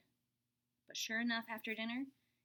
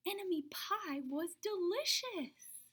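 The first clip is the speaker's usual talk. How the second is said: gasp, then slow, higher, delighted; delighted surprise; 8 semitones higher, 4 dB louder, slower than usual. A narrator's reading. Narrator gasping that the pie tastes delicious. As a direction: savour the discovery.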